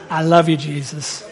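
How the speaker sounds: low, soft mock devotion; butter him up softly; hushed, put-on sweet talk; mock adoration. Acted insincere declaration of love.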